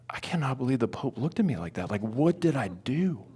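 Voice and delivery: near-whispered self-talk; soft, breath-heavy bewilderment to oneself; hushed disbelief. Retold or imitated. Speaker alone driving, replaying the Pope's look, whispering in disbelief. Muttering to himself while driving, bothered.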